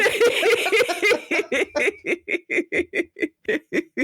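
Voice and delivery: long high laughter; a hearty drawn-out laugh. Real, not acted. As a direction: laugh freely at the idea. Host laughs while urging.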